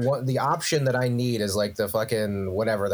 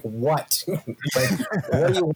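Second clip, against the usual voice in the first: pitched up, fast, half-laughing mock clerk; rude phone clerk; 4 semitones higher than usual. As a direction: snap it out like an annoyed clerk caught off guard. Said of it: "She almost answered it like".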